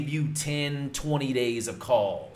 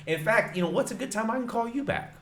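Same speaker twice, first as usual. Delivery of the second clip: much higher, friendly homeowner voice; homeowner's voice; 11 semitones higher, 3 dB louder, faster than usual. Retold or imitated. Homeowner agrees to a callback, voiced high and friendly. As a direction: lightly play the eager homeowner, raised high. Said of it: "But he goes".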